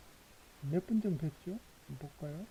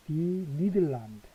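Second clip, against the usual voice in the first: 디 니들란드 said very slowly and a bit louder; slow model; 5 dB louder, much slower than usual. Shown as a demonstration. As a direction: stretch out 디 니들란드 for dictation. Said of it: "정관사가 붙는 국가".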